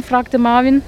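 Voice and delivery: usual speaking voice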